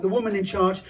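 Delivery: ordinary talk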